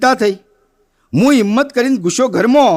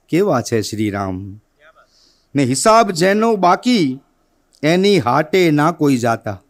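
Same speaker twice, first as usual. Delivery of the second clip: slow, lowered sing-song recital of verses; poem recitation; 5 semitones lower, slower than usual. Acted. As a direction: let each line land like a stage poem. (કવિતાનું ધીમું પઠન)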